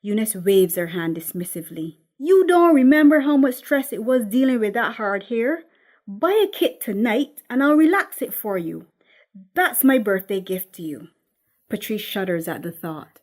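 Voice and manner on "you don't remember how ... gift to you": louder, higher, pushy offer; insistent mother. Acted. Mother pushing a relaxer on her daughter as a birthday gift, loud and insistent.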